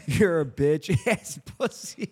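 cracking up mid-insult; slow mock insult broken by laughs; failed dead serious. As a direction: try to say it seriously but break into laughter. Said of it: "like, dead ass serious".

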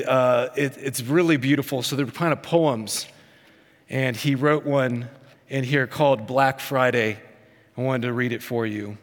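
usual speaking voice

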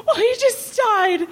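high, tearful mock old-lady voice; imitating a crying old lady. Imitating Joan Rivers crying to the writer on the phone, high and tearful. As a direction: squeeze it out high like a weepy friend. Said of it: "And she was indeed crying".

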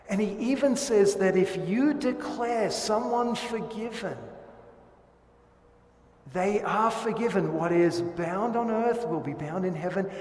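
loud, ringing pulpit proclamation; full-voiced sermon declaration; preaching at full force. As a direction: drive the promise home to the congregation. Preacher raising voice on key promise.

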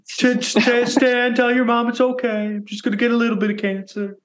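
high, quick cartoon kid; mock cartoon kid voice. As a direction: chirp it like a cartoon boy. Joking voice after cancer talk.

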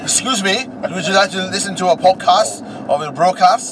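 high, loud mock-polite host voice. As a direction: ask it like a prissy presenter.

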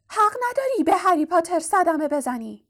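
high-pitched defiant elf voice; house-elf crying out. A narrator's reading. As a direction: cry out protectively as a little elf. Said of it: "بلافاصله دابی فریاد زد".

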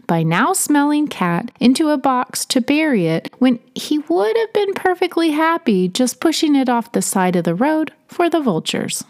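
ordinary delivery

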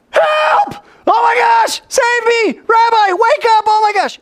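very high, loud cries for help; frantic shouts to wake the rabbi; panicked screaming. Acted. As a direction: act out scared-to-death men in a storm. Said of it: "they're scared to death".

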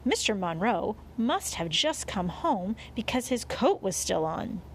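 plain reading voice

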